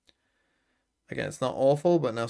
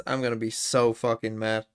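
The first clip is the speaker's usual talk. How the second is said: ordinary talk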